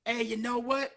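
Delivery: ordinary talk, nothing marked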